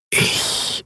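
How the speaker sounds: slow, voiceless disembodied whisper; ghostly whisper. A narrator's reading. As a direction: breathe it like a spirit. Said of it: "Flüsterstimme". (körperloses, langsames Flüstern)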